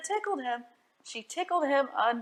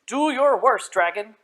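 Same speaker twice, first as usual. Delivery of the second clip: loud challenge to the dragon; defiant knight's cry; bold declaration; 8 dB louder than usual. Acted. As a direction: throw down the gauntlet. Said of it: "declared the shiny man".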